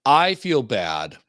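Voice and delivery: "I" drawn out slowly and leaned on; slow it right down and land on "I"; slow, stressed I. Shown as a demonstration. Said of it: "So you have to say, "I".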